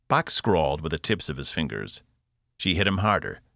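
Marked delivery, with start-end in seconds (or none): none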